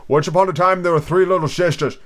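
lightly voiced sleepy creature; hurried Dormouse. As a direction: launch hastily into a tale. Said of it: "began in a great hurry".

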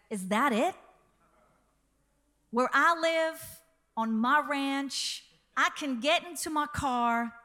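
a light American drawl, loud on the opener; hamming up an American ranch owner; American accent. Retold or imitated. American rancher boasting to a British farmer, light American accent. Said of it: "Do you like my accent?".

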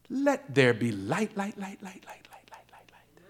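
light repeated and fading to a whisper; preacher voicing God, light echoing away. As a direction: let it echo away into nothing.

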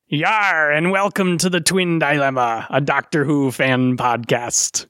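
hammed-up pirate podcast intro; heavy mock-pirate host, slowing at the end. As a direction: growl out a welcome like a stage pirate. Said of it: "I went heavy on the pirate there".

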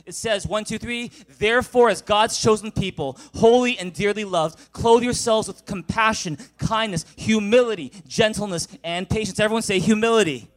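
loud, high scripture read to the room; full-force preaching. Real, not acted. Pastor leading the congregation in loud reading.

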